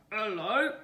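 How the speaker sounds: loud croaky frog greeting; croaking frog. A narrator's reading. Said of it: "he croaked".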